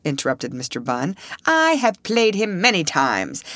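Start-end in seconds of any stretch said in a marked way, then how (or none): 1.5-3.4 high, slow, self-important declaiming; theatrical actor. A narrator's reading. Actor boasting of playing Othello, theatrical. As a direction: stretch it out with stage airs. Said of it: "airs he was wont to assume in the theater".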